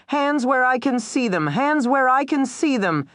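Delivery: high commanding police shout; officer shouting at the armed man. A narrator's reading. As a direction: bark the order at the suspect. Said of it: "Officer Makarith shouted".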